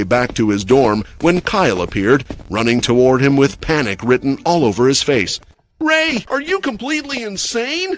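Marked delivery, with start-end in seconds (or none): 5.8-8.0 shouting. A narrator's reading. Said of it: "Kyle shouted".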